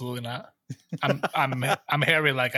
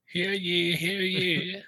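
town crier; 4 semitones higher, much slower than usual. Pretend. Mock club meeting proclamation.

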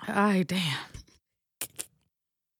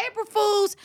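teasing April Fools; 9 semitones higher than usual. Retold or imitated. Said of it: "I come on back like".

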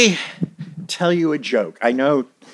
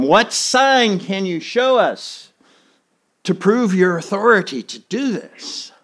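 mock demand; 8 semitones higher than usual. Leaders demanding a sign.

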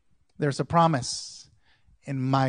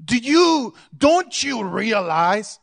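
Pilate demanding; 6 semitones higher, 6 dB louder, slower than usual. Acted. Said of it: "Pilate said".

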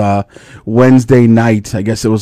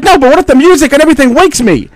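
high, fast worried whine; anxious daughter; 14 semitones higher, 3 dB louder, faster than usual. Retold or imitated. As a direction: rush the worry out. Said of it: "She's like".

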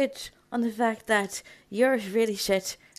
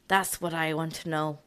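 ordinary talk

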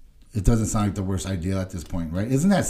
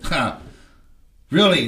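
short scoffing huh, then louder "really"; imitated scoff; 4 semitones higher, 5 dB louder, slower than usual. Parole officer reacting to an addict's confession, imitated scoff. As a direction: imitate someone scornfully unsurprised. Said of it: "like, he scoffs".

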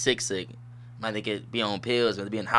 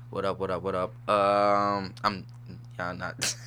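ordinary talk

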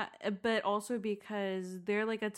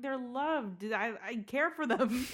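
higher, louder comic protest; exasperated; 5 semitones higher, 4 dB louder than usual. Retold or imitated. Daughter defending her dog care to parents, exasperated. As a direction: build to a laughing, aggrieved plea.

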